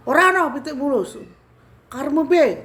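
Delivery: high, loud Javanese rebuke; bentakan. Retold or imitated. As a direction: snap back loudly, acting out the retort. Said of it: "Terus saya bentak lagi". (membentak keras bernada tinggi)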